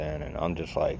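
ordinary talk, nothing marked

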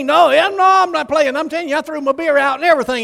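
high, loud, rushed protest; insistent protest. Retold or imitated. Acted insistent protest to preacher.

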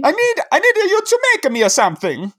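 high mock Italian accent. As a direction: plead in a hammed-up Italian voice. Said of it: "make me do an accent".

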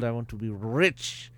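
ordinary talk, nothing marked

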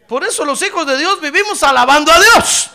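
loud, high preaching cry; preaching at full force. Acted. Preacher urging believers to praise always, loud. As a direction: lift it up and preach hard.

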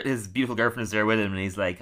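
ordinary delivery

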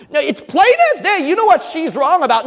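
high, loud, exasperated objection; protesting loudly at yourself; indignant inner protest. Acted. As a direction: argue back in a worked-up, high-pitched voice. Acts out angry inner protest.

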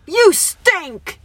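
high, loud mock heckle; retold scream. Friend retelling a heckler's scream from a passing car, mock yell. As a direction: imitate a yell from a car window. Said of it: "screamed at us from an open car window".

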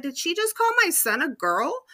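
high-pitched incredulous question; mock outraged mom voice; indignant disbelief. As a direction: ask it like you can't believe your ears. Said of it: "And I was like".

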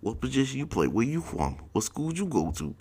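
imitating the gold-teeth teammate asking everyone; gold-teeth guy voice. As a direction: chirp the questions in his odd voice. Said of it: "he's asking everybody".

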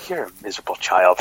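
hammed-up dad telling off his kid; mock scolding. Dad telling off his cheeky daughter, mock stern. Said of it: "I look at my daughter and I go".

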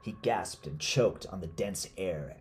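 plain reading voice

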